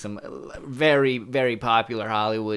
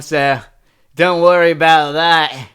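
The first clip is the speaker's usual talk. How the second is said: loud, higher-pitched hammed-up Australian; 4 semitones higher, 10 dB louder, slower than usual. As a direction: belt it out like a cartoon Aussie. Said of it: "just act like an offensive stereotype".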